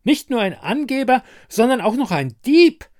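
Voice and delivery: loud, high-pitched accusation; furious high yelling; indignant shout. A narrator's reading. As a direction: shriek it like an outraged ice queen. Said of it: "schrie die Herrin des Eispalasts".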